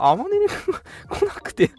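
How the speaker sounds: normal talking voice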